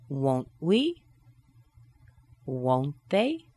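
won't I with the T held, rising; held T, questioning lift on the last word; rising tag. Shown as a demonstration. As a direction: swing each tag up at the end. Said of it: "nice and held inside".

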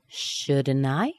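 "shouldn't I" linked as "shud-n-eye", rising; "shouldn't I" without T, questioning; dropped T. Shown as a demonstration. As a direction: drop the T in "shouldn't I" and go up. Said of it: "The T will be missing".

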